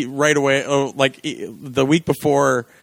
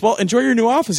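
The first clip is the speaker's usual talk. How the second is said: smug; 9 semitones higher than usual. Retold or imitated. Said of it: "And he goes".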